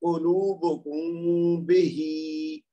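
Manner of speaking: very slow, the م of قلوبکم hummed through the nose for two beats; recited with ghunna. Shown as a demonstration. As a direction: stretch the م of قلوبکم nasally, lips apart, then بہی. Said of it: "اب ہم کیا کریں گے؟".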